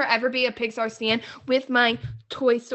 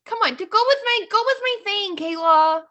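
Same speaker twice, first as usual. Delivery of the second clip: high, louder wheedle; coaxing plea; 10 semitones higher, 4 dB louder than usual. Real, not acted. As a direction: coax a friend to play along. Coaxing co-host to play along.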